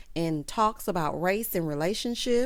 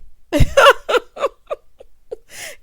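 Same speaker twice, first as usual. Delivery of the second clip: loud, high cackle; real high-pitched laughing fit; big laugh; 11 dB louder than usual. Real, not acted. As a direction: burst out laughing helplessly. Cracks up at Maurice's line.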